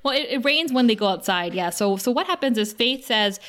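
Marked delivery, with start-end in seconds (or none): none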